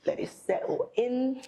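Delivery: a quick mock-British repeat of "Let it settle in"; British accent. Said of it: "That's my British accent".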